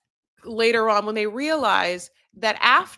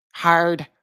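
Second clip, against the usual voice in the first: Hard said with an Irish lilt and a rolled R, a bit louder; accidental Irish accent; 5 dB louder than usual. Shown as a demonstration. Vlogger trying Scottish on one word, coming out Irish. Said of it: "No, that's Irish".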